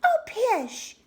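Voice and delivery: very high, loud scoffing mum; dismissive mother. A narrator's reading. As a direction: wave it away as a brisk mother. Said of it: "said her mother".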